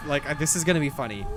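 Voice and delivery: normal talking voice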